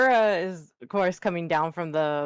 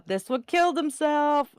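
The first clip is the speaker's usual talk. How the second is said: higher-pitched call down to a companion; mock yell; 7 semitones higher than usual. Looking over the ledge at Utah, mock yell. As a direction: call it down without really shouting. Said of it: "yelling down at Utah".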